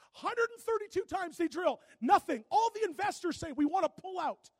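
loud, rising sermon build; preaching at full force. Acted. Pastor preaching to his church, loud and rising. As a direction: drive it home to the congregation.

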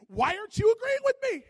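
loud high exasperated demand; almost yell it at her; upset. Retold or imitated. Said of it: "getting more upset".

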